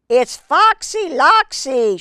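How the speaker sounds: terrified hen spotting the fox; shriek. Acted. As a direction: shriek the name in alarm. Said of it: "she shrieked".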